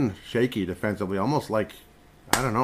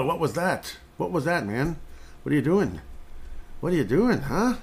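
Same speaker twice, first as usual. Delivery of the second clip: high mocking scolding of a fumbling player; 6 semitones higher than usual. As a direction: tease him like an exasperated fan.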